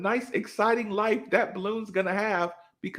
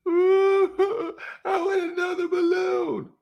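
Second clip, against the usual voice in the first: put-on much higher, whining child voice; whiny kid; 11 semitones higher than usual. Comic imitating the child demanding a new balloon, high whine. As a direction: beg like a spoiled little kid.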